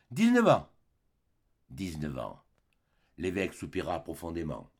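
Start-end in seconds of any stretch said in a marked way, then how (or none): 0.1-0.7 éclat. Acted. L'homme répète son temps de bagne. 1.7-2.4 répété tout bas. Acted. L'homme répète son temps de bagne.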